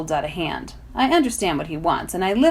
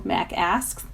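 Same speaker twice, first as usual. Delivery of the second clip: plain reading voice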